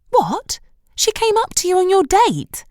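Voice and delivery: high, incredulous question; shocked. A narrator's reading. Shelley on the phone hearing about the date, shocked. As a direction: gasp it out in disbelief.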